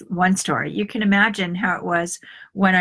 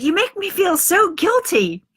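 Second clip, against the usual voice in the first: higher, whiny boy's voice, lightly acted; be the kid pinning the blame on mom; imitating young son; 10 semitones higher, 4 dB louder than usual. Mother voicing her son complaining she makes him guilty, higher whiny voice.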